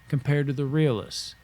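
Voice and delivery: normal talking voice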